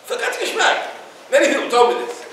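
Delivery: usual speaking voice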